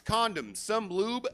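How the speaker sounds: usual speaking voice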